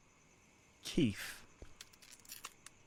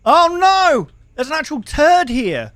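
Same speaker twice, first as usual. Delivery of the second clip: high, loud cry of dismay; horrified outcry; 9 semitones higher, 10 dB louder than usual. Real, not acted. Player finding mess below the counter, horrified shout. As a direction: wail at a revolting discovery.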